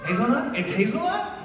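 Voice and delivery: high-pitched coffee excitement; thrilled, disbelieving high voice; delighted surprise. Real, not acted. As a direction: squeal with rising delight.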